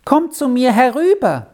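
put-on high-pitched call from a boy; boy's high summoning call; calling over. A narrator's reading. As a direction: call someone over to you, pitched well up. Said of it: "ertönte von der Birke her der Ruf".

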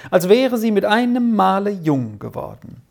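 usual speaking voice

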